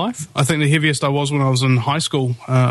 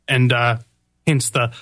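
usual speaking voice

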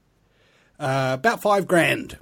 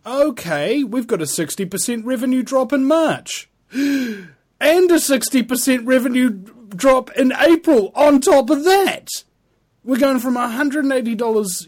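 panicked; 10 semitones higher, 6 dB louder than usual. Retold or imitated. Voicing panicked YouTubers over revenue drop.

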